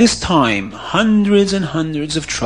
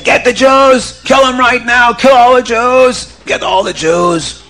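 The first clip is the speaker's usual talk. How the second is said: mob shout; 9 semitones higher, 5 dB louder than usual. Acted. Mob calls to attack.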